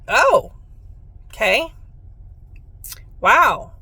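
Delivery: high, loud "oh, okay, wow" with a sarcastic edge; a retold, exaggerated double take; mock surprise. Retelling her reaction to her sister, in mock surprise.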